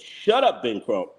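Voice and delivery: ordinary delivery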